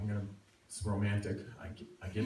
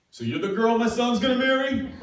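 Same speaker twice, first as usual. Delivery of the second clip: 1 blurting dad; 13 semitones higher, 8 dB louder than usual. Retold or imitated. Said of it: "he blurts out".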